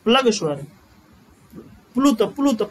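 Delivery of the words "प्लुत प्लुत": प्लुत said with a clear pl and short u; correct pronunciation. Shown as a demonstration. Teacher correcting a student's plug to प्लुत, said crisply. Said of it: "प्लग नहीं प्लुत".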